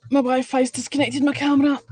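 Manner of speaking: ordinary talk, nothing marked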